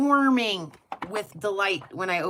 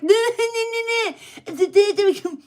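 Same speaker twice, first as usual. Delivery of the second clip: higher, louder, laughing "no, no"; half-laughing plea to be filmed; giddy protest; 8 semitones higher, 6 dB louder than usual. Retold or imitated. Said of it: "I'm like".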